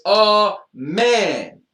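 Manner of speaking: loud high "Oh" then "man"; big "Oh, man". Shown as a demonstration. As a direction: punch the "Oh" up high.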